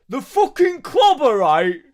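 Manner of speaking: hammed-up angry lad from the pub bellowing; pub guy rant. Streamer doing the angry pub guy for his chat, loud mock yell. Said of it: "pretend to be a guy from the pub".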